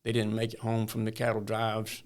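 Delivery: ordinary delivery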